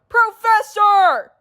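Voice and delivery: loud, slow, high calling out; drawn-out shout. Acted. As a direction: call him across the chaos. Calling in evacuation.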